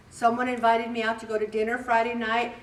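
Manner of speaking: ordinary delivery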